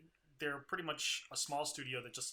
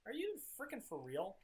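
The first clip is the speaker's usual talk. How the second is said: incredulous; 8 semitones higher, 6 dB quieter than usual. Retold or imitated. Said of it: "I'm just like".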